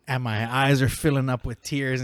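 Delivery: ordinary delivery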